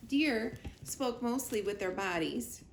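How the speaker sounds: ordinary delivery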